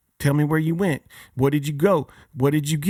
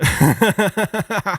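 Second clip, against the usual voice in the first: burst of laughter; 4 semitones higher, 4 dB louder than usual. Real, not acted. Laughs at own horse plan.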